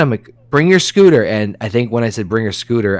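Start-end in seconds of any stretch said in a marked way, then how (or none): 0.5-1.2 raised call across the street. Retold or imitated. Calling to daughter to bring her scooter, raised voice. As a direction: call her over, a bit louder. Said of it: "I wasn't even yelling".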